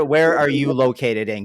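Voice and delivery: usual speaking voice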